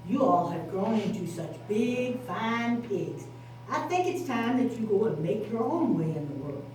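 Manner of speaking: Mama Pig. Acted. Mother tells grown pigs to leave home. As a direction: tell them kindly it's time to go. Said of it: "their mother said".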